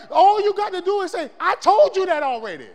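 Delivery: loud preaching. Retold or imitated. Preacher voicing God's rebuke, loud and firm. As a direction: declare it with full force.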